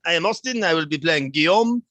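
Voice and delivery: put-on French accent. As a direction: introduce yourself in a bold French accent. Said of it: "The intro will always be in the French accent".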